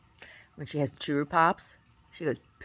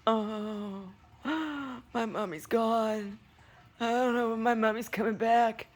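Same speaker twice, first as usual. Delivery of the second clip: high, slow mopey dog voice; voicing the dog; 9 semitones higher, slower than usual. Voicing Brody, a high whiny pup pining for Mommy. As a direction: play a sad pup missing Mommy. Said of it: "Do you just lay around like this".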